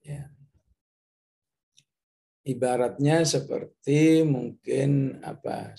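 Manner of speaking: usual speaking voice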